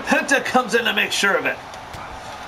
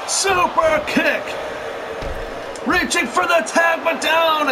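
excited live play-by-play, raised voice; wrestling commentator; 3 semitones higher than usual. Real, not acted. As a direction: call the action as it happens.

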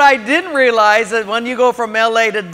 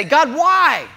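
high, anguished plea; acting out upset questioning; loud, drawn-out complaint; 8 semitones higher, 6 dB louder, slower than usual. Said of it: "sometimes we get upset and say".

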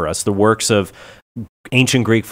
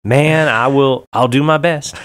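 put-on slow, drawn-out Cajun accent, pitched up; attempted Cajun accent; 4 semitones higher, slower than usual. Guest filling in for Mister Carlo, mock Cajun accent. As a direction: stretch it out in a would-be Cajun accent. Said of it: "You can't do a Cajun accent".

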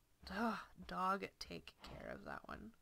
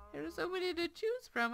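higher repeat; 8 semitones higher, 6 dB louder than usual. Shown as a demonstration.

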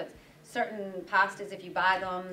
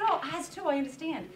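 sympathetic; 8 semitones higher than usual. Retold or imitated. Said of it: "I said".

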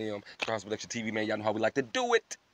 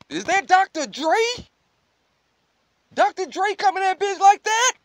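high, loud, stunned disbelief; loud incredulous questioning; real astonishment; 8 dB louder than usual. Real, not acted. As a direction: burst out in high-pitched amazement. Shocked reaction to the track.

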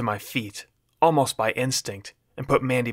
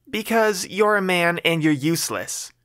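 snapping woman; 7 semitones higher than usual. Acted. Mandy snapping at the man who helps her, higher irritated voice. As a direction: snap back at him, much higher.

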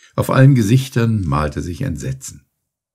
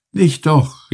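higher, louder urgent call; 9 semitones higher, 4 dB louder than usual. A narrator's reading. Penelon halting the others on the ice, calling out. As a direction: stop them with a raised cry. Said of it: "rief Penelon".

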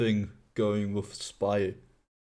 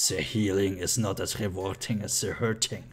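lightly voiced German medic, lower and softened; a hint of the medic in a lower voice; medic impression; 5 semitones lower, 4 dB quieter than usual. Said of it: "a really good medic impression".

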